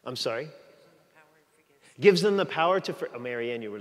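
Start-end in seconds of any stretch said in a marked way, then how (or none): none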